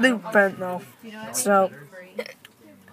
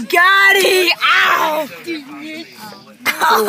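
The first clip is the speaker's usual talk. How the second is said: shout; 6 semitones higher, much slower than usual. Real, not acted. Being hit, crying out.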